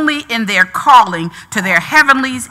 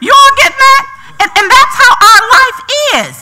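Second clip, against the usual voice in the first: loud high building preach; preaching at full force; 5 semitones higher, 4 dB louder than usual. Real, not acted. Preacher rallying the church, building full-force preaching. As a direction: lift the congregation higher and higher.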